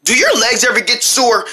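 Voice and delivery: normal talking voice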